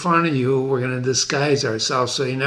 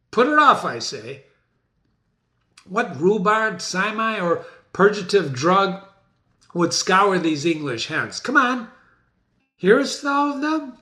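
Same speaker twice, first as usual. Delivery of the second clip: raised, impatient king; teacher acting Macbeth, higher and agitated; 5 semitones higher than usual. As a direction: snap orders as a tyrant. Macbeth commanding again.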